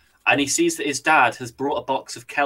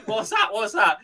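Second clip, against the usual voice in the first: mock panic; 9 semitones higher than usual. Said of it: "She freaks the fuck out".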